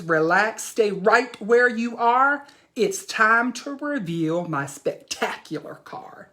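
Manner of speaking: put-on lively read-aloud as young Jack; animated picture-book reading; reading to children. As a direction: tell the boy's boast to the class.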